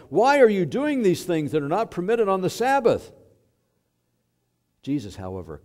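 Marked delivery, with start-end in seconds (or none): 0.1-3.1 loud, high-pitched scolding shout; angry shout. Acted. Father confronting the child Jesus on the Sabbath, shouted rebuke. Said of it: "he shouted".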